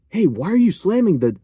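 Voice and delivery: high-pitched mock reprimand; imitated teacher scolding. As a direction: imitate a teacher indignantly calling out a student.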